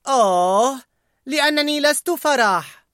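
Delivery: high, scornful voiced character; disgusted Disgust. A narrator's reading. Disgust snapping back at Fear, scornful. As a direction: scoff it high and sneering. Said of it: "قالت اشمئزاز".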